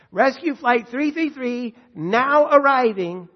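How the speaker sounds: loud, high, slow PA announcer; airport announcement. Shown as a demonstration. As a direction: boom it out over the terminal speakers. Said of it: "Here's the announcement, uh, at the airport".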